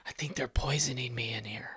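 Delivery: hushed, fearful whisper. Retold or imitated. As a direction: whisper it like a secret. Telling wife of hallucinated poison.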